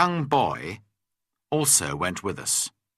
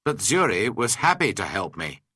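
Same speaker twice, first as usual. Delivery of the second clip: usual speaking voice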